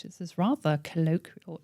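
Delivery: whispered prim disapproval; mock posh dad. Dad scolding her casual emails, prim whisper. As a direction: hush it like a prim father.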